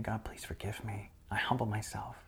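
soft, whispered, tearful prayer; hushed weeping prayer to God. Retold or imitated. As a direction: whisper it like a broken confession. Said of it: "started weeping and saying".

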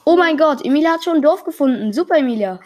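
loud, high, fast burst of delight; thrilled shout of praise to the player; excited cheer. Real, not acted. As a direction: cheer her on like she scored a goal. Cheering child finding village.

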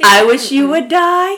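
slow, high, rising lilt; mock-sweet retort. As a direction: draw out the joke threat in a high voice. Joking retort to husband, drawn out.

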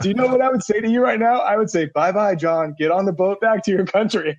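high, giggling retelling of the harsh line; can barely get the words out for laughing; laughing through the quote. Retold or imitated. Retelling the coach's brutal dismissal to the hosts, laughing hard.

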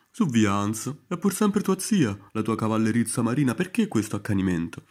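voce del maresciallo, più forte; un maresciallo che rimprovera bonario, più alto e forte. Acted. Maresciallo parla a Hans. (maresciallo che calma Hans, voce forte e acuta)

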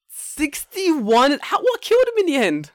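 high, loud astonished cry; amazed. Real, not acted. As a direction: shout it in disbelief. Amazed reaction.

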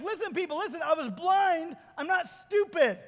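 high-pitched, insistent retort; exasperated protest. Acted. Healed man to questioning people, exasperated. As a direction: spell it out in comic exasperation.

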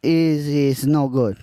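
light put-on Spanish accent. Improv scene as Juan.

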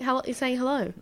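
normal talking voice

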